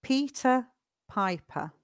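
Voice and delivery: each word set apart by a pause; slow. Shown as a demonstration. Teacher repeats the name for students, slowly. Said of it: "Let's do it slowly together".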